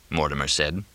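ordinary delivery

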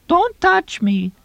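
very high, slow mocking falsetto. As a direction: squeal it in a slow put-on falsetto. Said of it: "Tonino said in falsetto".

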